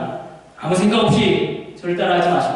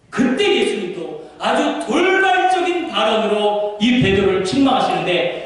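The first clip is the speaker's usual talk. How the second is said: usual speaking voice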